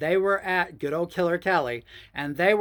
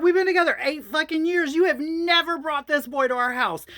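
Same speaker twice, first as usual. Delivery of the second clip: much higher, louder angry yelling; retold furious scream; 10 semitones higher, 5 dB louder than usual. To husband Mickey over his friend, furious screaming. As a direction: tear into him at full volume. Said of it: "I screamed at him".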